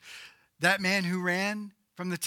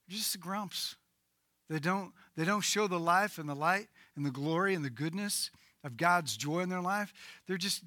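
ordinary talk

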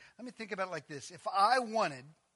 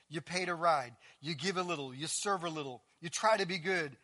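ordinary talk, nothing marked

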